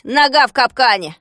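loud, mocking echo of the complaint; gruff, dismissive grumble; grumbling. A narrator's reading. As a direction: throw his words back with scorn. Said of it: "проворчал Фикс".

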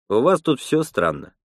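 usual speaking voice

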